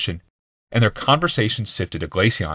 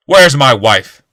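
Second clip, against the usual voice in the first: furious demand; 5 semitones higher, 11 dB louder, much slower than usual. A narrator's reading.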